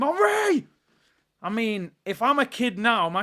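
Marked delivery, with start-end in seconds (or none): 0.0-0.7 high shouted player name as you shoot; playground shout. Retold or imitated. Kid in the playground shooting and yelling the striker's name, a high yell. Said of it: "you shoot and you say a player's name".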